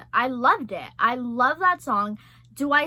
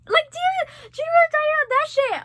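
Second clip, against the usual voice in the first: much higher and louder, gushing; excited; 14 semitones higher, 5 dB louder, much faster than usual. Real, not acted. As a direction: shriek the question at a friend. Excited recalling movie scene.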